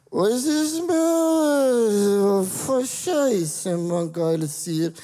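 put-on high, slow slurred drunk babble. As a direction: slur it like a drunk. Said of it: "Wenn man betrunken ist".

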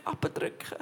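"abdrücken" breathed out as a faint whisper; the last "abdrücken" whispered as the voice gives out; fading to a whisper. Real, not acted. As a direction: let "abdrücken" die away into a whisper. Repeating the word, fading.

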